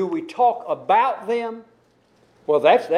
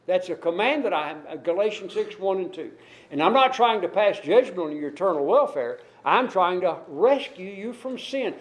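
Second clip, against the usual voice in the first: ordinary talk, nothing marked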